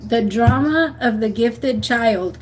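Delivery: slow, stressed title repeat. Shown as a demonstration. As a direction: repeat it slowly so they remember. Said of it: "I promise you that book".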